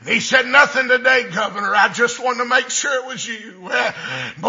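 ordinary talk

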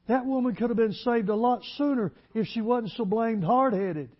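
loud, high, driving pulpit voice; fiery preaching. Acted. Preacher to church, loud fiery sermon delivery. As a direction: preach it hard to the congregation.

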